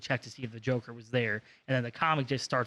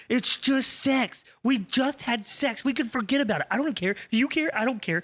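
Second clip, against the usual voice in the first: mock Batgirl; 10 semitones higher than usual. Batgirl begging him to stay, high mock whine. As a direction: whine it out like a clingy ex. Mocking Batgirl's pleading lines.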